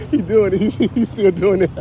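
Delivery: can't stop laughing at him; amused laughing. Real, not acted. Vlogger watching the man keep going, laughing.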